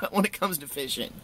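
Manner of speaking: high giggle that spills into the words; laughing. Real, not acted. Bragging to viewers about fishing, giggling. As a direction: laugh it out, a bit sheepish.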